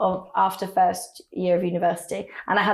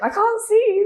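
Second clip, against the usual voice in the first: calling out; 13 semitones higher, 4 dB louder than usual. Retold or imitated. Calling down from balcony.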